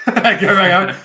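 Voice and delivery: laughing loudly while asking. Real, not acted. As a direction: burst out laughing mid-request.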